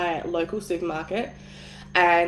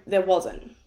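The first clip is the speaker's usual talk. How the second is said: ordinary delivery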